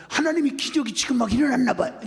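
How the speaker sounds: high, excited mock awe; gushing amazement. Congregant telling pastor of a miracle, mock amazement. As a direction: imitate a congregant thrilled by a miracle.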